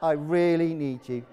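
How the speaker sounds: low monotone, deliberately lifeless; mock unenthusiastic. Preacher mimicking the congregation's limp response, deadpan and unenthusiastic. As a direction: imitate a half-hearted crowd, pitch dropped. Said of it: "that was really enthusiastic".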